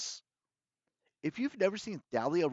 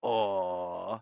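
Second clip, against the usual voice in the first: low, drawn-out mocking coo; mock pity; 6 semitones lower than usual. A narrator's reading. Villain mocking Bianca's plight, low mock pity. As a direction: feign sympathy in a low voice. Villain mocks girl's distress.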